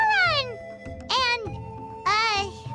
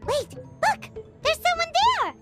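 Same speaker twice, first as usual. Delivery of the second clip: high-pitched, urgent alert; excited, pitch jumping up; startled discovery; 7 semitones higher than usual. Acted. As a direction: alert a companion to a sudden sighting. Spots a person lying there.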